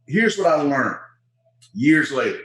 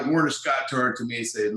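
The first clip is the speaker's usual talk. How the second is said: ordinary talk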